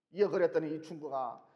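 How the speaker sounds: usual speaking voice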